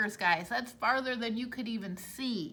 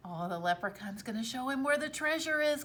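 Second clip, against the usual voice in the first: ordinary delivery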